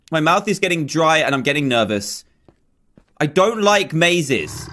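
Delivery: normal talking voice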